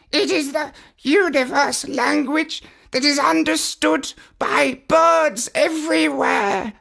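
high, earnest parrot voice, near tears; a smitten bird pleading to save his love, pitched high; high parrot voice, slowing at the vow; lovesick parrot. A narrator's reading. Parrot voice again.

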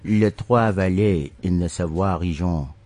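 slow, careful French accent. Shown as a demonstration. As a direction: say the place names with a French accent. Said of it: "I'm actually working my, my French accent".

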